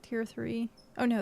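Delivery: usual speaking voice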